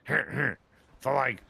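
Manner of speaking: giggling cartoon teen voice; Beavis voice. Shown as a demonstration. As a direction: cackle into a Beavis line. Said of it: "when I do Beavis".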